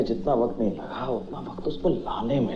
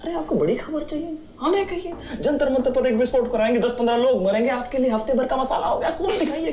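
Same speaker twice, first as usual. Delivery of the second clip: high-pitched, quick, breaking into laughter; gleeful scheming; 12 semitones higher, faster than usual. Acted. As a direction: pitch the wicked plan fast and high, laughing. Gleefully proposing a blast as news.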